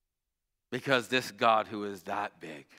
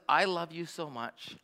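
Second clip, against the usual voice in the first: daughter's sincere words; 4 semitones higher, 4 dB quieter, slower than usual. Retold or imitated. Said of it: "She says".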